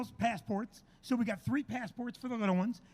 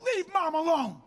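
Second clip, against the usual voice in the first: raised, drawn-out mock scolding; daughters' protest; 6 semitones higher, 5 dB louder, slower than usual. Dad mimicking his daughters defending their mom, protesting yell. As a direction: imitate kids yelling at their dad in unison. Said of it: "all three of my daughters go".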